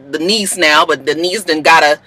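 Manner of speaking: usual speaking voice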